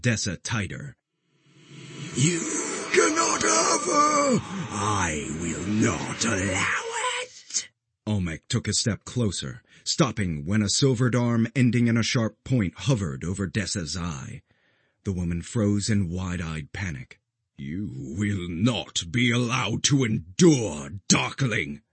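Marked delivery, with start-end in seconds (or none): 2.1-7.7 furious shout. A narrator's reading. Creature refuses to let go. 17.6-21.8 slow, firm guardian vow; grave and steady threat; solemn promise. A narrator's reading. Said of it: "the Guardian promised".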